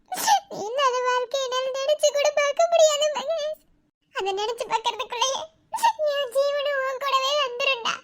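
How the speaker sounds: high, breaking voice through tears; sobbing plea. Acted. Wife begging Mahesh not to leave her, sobbing. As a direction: plead through sobs.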